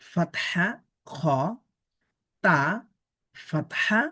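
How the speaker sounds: ordinary delivery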